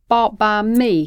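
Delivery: loud, emphatic claim of credit; chest-thumping declaration; proud boast. Acted. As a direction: boast it out loudly. Boyfriend boasts, thumping his chest.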